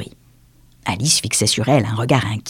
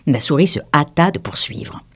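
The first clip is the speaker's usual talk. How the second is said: plain reading voice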